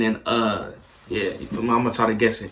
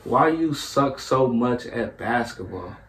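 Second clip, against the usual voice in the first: slow, softer, word by word; slow delivery; 6 dB quieter, slower than usual. Real, not acted. Player repeats basketball jab for guessing friend, slowly.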